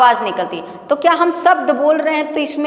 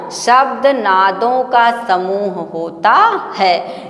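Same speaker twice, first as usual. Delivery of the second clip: very slow, each word set apart; deliberate, drawn-out teacher's example; word-by-word demonstration; 3 semitones lower, much slower than usual. Shown as a demonstration. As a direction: stretch the sentence out so every word can be counted. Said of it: "इतना शब्द हुए कि नहीं हुए ना".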